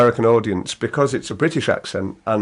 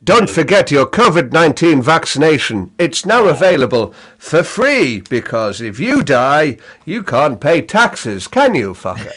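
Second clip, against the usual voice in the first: mock public announcement. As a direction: deliver a spoof public health message.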